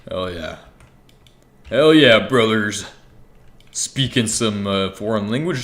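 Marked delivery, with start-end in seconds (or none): 0.1-0.6 lower, softer hell yeah, under the breath; muted hell yeah. Acted. Repeats it, then loud. 1.7-2.9 hyped shout. Acted. Streamer hyping up his viewers, loud cheer.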